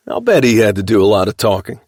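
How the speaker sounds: sarcastic. A narrator's reading. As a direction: needle him with mock disbelief. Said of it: "Geordie said sarcastically".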